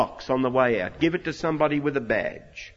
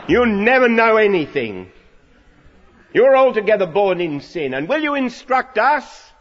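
angry shout; 6 semitones higher, 8 dB louder than usual. Retold or imitated. Pharisees shouting down the man.

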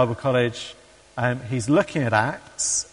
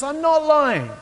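very high, loud, exaggerated objection; mock-indignant retort; protesting denial; 14 semitones higher, 5 dB louder, slower than usual. Accused man protests back.

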